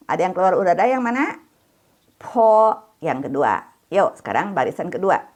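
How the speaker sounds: usual speaking voice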